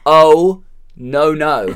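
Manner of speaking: just deliver the line; director's plain take. Retold or imitated. The director showing Nick how to say it. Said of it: "we just want you to deliver the line".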